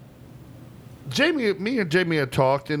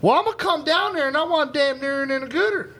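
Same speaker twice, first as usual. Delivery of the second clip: country caller voice; 12 semitones higher than usual. Retold or imitated. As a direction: ham up the country customer's order. Caller voice, pitched high.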